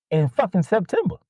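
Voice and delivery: high-pitched exasperated snap at a pestering customer; exasperated retort. Retold or imitated. Answering a customer who keeps asking, exasperated snap. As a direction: spit out the month, fed up.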